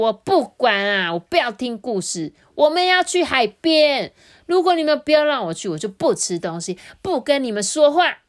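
put-on a stubborn kid shouting demands at his parents; tantrum. Bobo throws a tantrum. (发脾气的小孩大声嚷嚷)

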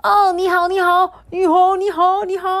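high, loud chirpy greetings; chorus of sparrows. Acted. The sparrows greet Dudu Xiang in chorus, high and loud. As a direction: chirp hello all together. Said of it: "异口同声地说".

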